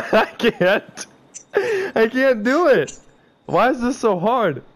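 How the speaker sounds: high voice, on the edge of laughter; helpless giggling protest. Real, not acted. Friend failing at the accent, high and giggly. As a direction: whine it while cracking up. Cracking up during failed attempts.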